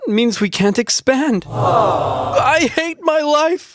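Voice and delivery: high, drawn-out anguished wail; despairing wail. Acted. Cartoon character despairs over land.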